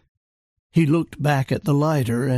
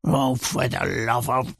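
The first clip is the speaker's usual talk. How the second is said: Churchill muttering; 4 semitones lower than usual. A narrator's reading. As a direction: grumble it under your breath, fed up. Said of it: "Churchill muttered".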